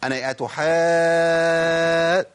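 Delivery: أتحا held very long on the alif; long drawn madd. Shown as a demonstration. Teacher chanting the obligatory madd in recitation. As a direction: stretch the madd in أتحا slowly and loudly. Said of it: "ده النطق الصحيح للجيم".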